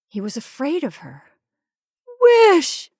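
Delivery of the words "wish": very high, loud command; piercing, insistent shout; strong-voiced cry. A narrator's reading. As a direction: cry out the order forcefully. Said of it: "she cried in a strong voice".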